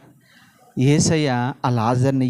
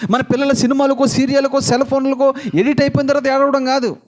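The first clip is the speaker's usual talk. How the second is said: fervent preaching; 9 semitones higher than usual. Acted. Preacher warning parents about their children, fervent and raised. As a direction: hammer each refrain home.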